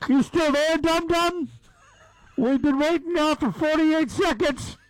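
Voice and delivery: loud, high mocking worker voice; impatient drive-thru worker. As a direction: needle the slow customer. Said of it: "The guy's like".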